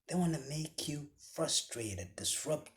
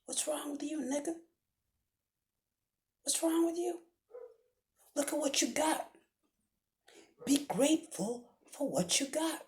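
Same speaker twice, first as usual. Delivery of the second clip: high, strained, mocking jealous voice; mocking jealous man; 12 semitones higher than usual. Preacher mimicking a jealous clubgoer, high and mocking. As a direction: squeak it out like a petty jealous guy.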